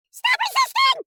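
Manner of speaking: high, loud cop yell. Pretend. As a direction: shout it as an order. Cop yelling at suspect.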